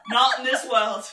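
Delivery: emphatic refusal breaking into laughter; laughing protest. Real, not acted. Refusing to agree to disagree over Sean Bean, laughing.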